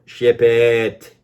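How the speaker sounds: loud, high, drawn-out mock order; mock careless shout. Customer mimicking the builder shipping shoddy work, mocking shout. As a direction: imitate a builder hollering to get rid of it.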